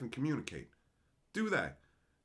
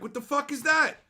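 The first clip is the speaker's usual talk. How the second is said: loud, high confused rant; incredulous yell at the screen; baffled outburst; 9 semitones higher, 6 dB louder than usual. Retold or imitated. Reacting to anime emojis, loud confused yell. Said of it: "And it's like".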